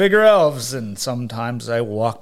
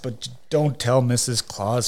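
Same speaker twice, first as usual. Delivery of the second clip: ordinary delivery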